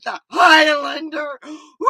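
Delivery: loud, high mock cheer of excitement; excited dad. Daughter imitating dad before movie night, excited whoop. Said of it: "my dad was so excited".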